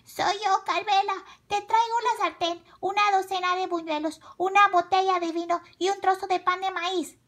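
put-on high girlish voice; little girl. A narrator's reading. Carmela at the door with her delivery, high girlish voice. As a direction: announce yourself in a high child's voice.

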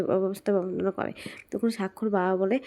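ordinary delivery, nothing marked

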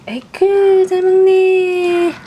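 high, slow, squealing praise. Real, not acted. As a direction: squeal with delight at the pup. Cooing at the dog eating.

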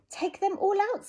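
high bright voice for the wise man; wise old sage. Acted. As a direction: give the advice cheerfully. Wise old man's advice.

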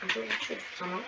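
soft whisper under the breath; hushed whispered aside. Real, not acted. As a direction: whisper it to yourself.

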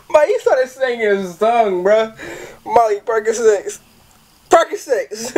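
high, slow giggling delivery; laughing so hard the words stretch. Real, not acted. Telling viewers about the song, laughing.

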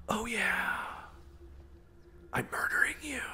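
high, soft, whispered gloat; creepy mock whisper. Gamer mocking whispering before a sneak attack, creepy mock whisper. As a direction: whisper it like a hammed-up sneaking killer. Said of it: "right before I murder them go".

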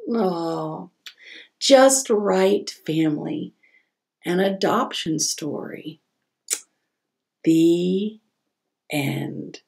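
bright, lively storybook reading; reading to children. A narrator's reading. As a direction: read it like story time for kids. Reads picture book aloud.

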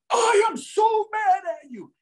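high-pitched, drawn-out angry yell; top of his lungs. Retold or imitated. Huge client raging at his therapist, imitated full-out yell. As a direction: bellow it slowly, imitating a furious giant. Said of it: "at the top of his lungs".

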